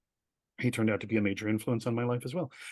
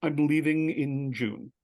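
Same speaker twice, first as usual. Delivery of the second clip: slow announcement; 3 semitones higher, much slower than usual. Retold or imitated. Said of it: "and I go".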